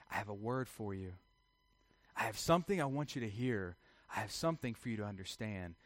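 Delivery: low, very soft voice; gentle, hushed address. Acted. God stooping down to speak to humanity, low and hushed. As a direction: speak softly down to a small listener.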